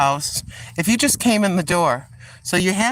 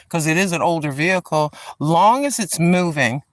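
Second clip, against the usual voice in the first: ordinary talk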